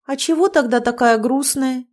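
plain reading voice

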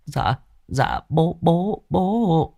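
put-on a scared boy stammering to his dad; frightened son stammering. Son stammering to his angry father, timid and rising.

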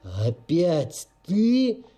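slow, menacing growl. Acted. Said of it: "зарычал санитар".

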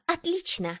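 high-pitched snappish outburst; blurted out. A narrator's reading. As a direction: blurt it out in spite. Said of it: "выпалила Зухра".

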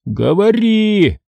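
high, drawn-out order to talk; menacing demand. A narrator's reading. Moose looming over Joe, menacing demand. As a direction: lean on him for a confession. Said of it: "повторил он, склонившись надо мной".

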